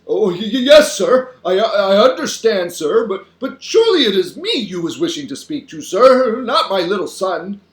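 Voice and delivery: high, loud, stuttering deference; flustered stammering. A narrator's reading. Father answering an important caller, flustered. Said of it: "his voice goes all funny".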